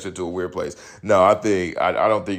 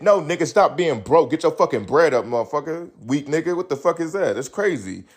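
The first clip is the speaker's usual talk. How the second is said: higher, heated rant; little outburst; 7 semitones higher than usual. Real, not acted. Ranting at the friend for being broke, high and heated. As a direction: rant at him with real heat. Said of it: "sorry, little outburst".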